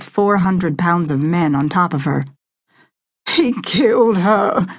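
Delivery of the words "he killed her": sobbed. A narrator's reading. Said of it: "Hunter Davidson sobbed".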